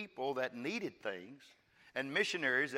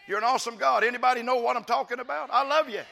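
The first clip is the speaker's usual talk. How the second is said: high, impassioned declaration of love to God; fervent preaching praise; 10 semitones higher, 9 dB louder than usual. Real, not acted. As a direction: pour out praise in a raised preacher's voice. Fervent praise within the sermon.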